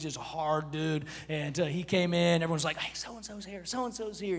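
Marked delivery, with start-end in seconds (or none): 2.8-4.4 very soft, low imitation of people passing word; hushed gossip. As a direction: quietly pass the news along. Acting out people passing word around.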